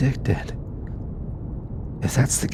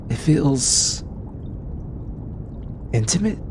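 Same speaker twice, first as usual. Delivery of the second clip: tentative, rising higher into a question; hesitant, shy; 5 semitones higher than usual. Acted. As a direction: edge up to the word uncertainly. Shyly searching for the word.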